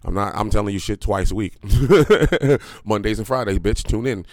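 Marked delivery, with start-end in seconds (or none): 1.6-2.8 loud laugh. Real, not acted. Laughing at his own rant.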